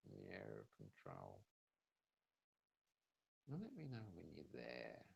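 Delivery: spa whisper. Acted. Hypnosis induction, eyes closed.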